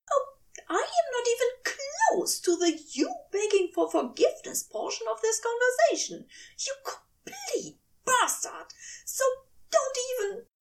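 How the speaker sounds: high, tear-choked fury; tearful angry angel. A narrator's reading. Aziraphale rages at Crowley, high and tearful. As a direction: lash out through tears.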